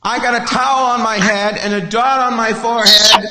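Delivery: loud, high indignant swami; mock outrage. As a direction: protest at full volume, hammed-up. Acted outraged swami refused entry.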